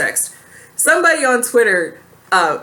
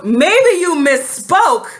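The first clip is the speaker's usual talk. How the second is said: high, slow disbelieving echo; scoffing at an excuse; incredulous; 7 semitones higher, 3 dB louder, slower than usual. Real, not acted. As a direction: repeat it in total disbelief.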